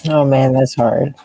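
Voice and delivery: ordinary delivery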